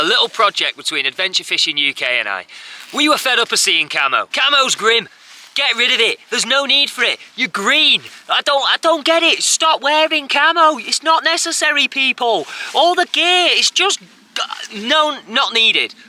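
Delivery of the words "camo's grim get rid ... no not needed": high-pitched, incredulous complaining; exasperated rant. Real, not acted. Angler fed up of seeing camo, exasperated high-pitched rant. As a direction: rant high, pleading with people to stop. Comic rant against camouflage clothing.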